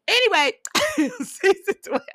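high, laughing squeal into the next topic. Real, not acted. Cutting herself off while getting mad, high laughing. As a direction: laugh away the anger and push on. Laughs off her own anger.

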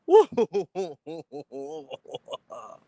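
a real burst of laughter; laughing. Real, not acted. Streamer laughs at the threat from the arm wrestling champ. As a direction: laugh at your own bit.

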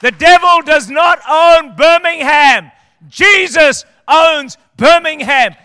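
loud, slow, high shouted proclamation; full-force preaching. Real, not acted. Pastor rallying the church against the devil, shouting. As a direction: thunder it out to the whole city.

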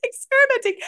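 high, shocked gasp of disbelief at the idea; mock horrified listener. Guest imagines host horrified by her remedy experiment, shocked high voice. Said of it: "You're like".

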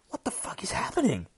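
high-pitched, baffled disbelief; bewildered inner monologue. Acted. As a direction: ask it in acted alarm. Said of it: "we got the inner monologue".

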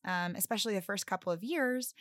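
normal talking voice

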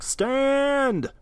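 high yelled call, drawn out; yelling. Retold or imitated. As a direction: shout it out high. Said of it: "he's yelling just like".

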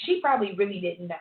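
ordinary delivery

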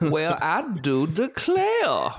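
put-on slow, drawn-out Southern American accent; mock Southern gentleman, very slow; Colonel Sanders Southern accent. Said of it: "it's like a Walmart own brand Colonel Sanders".

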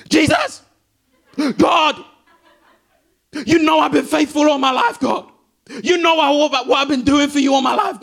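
loud, high, pleading cry that breaks into a shout on the last Jesus; desperate crying out to God. Pretend. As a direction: beg God at full voice like a desperate man before the crowd. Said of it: "imagine I'm literally like".

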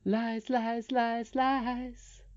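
high, drawn-out eerie voice; goblin taunt. A narrator's reading. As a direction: chant it as a teasing little goblin.

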